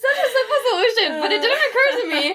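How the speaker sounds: talking through a high laugh; words bubbling with laughter. Real, not acted. Admitting an obvious solution to a friend, laughing. Laughing at own silly mistake.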